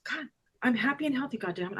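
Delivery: quick, soft, said as if only thinking it; hurried undertone, dropping away at the end; retold thought. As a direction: toss it off softly, like a private thought. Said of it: "I'm thinking this".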